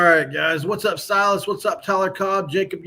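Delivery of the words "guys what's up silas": ordinary delivery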